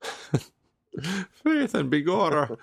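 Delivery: put-on high, slow stage Irish accent; hammed-up leprechaun Irish accent. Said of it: "It's green, you see".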